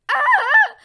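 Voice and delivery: very high, loud acted scream; shocked scream. Deku sees All Might's skinny form, shocked.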